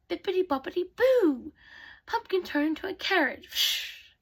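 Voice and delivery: high, emphatic spell with a swooping finish; fairy godmother casting. Acted. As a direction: chant the magic words with a flourish.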